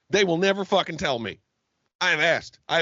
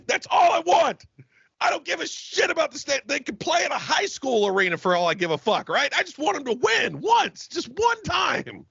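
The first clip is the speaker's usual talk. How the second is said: exasperated shout; 7 semitones higher than usual. Real, not acted. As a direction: yell it like a fed-up fan. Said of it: "I knew this would send him on a tirade".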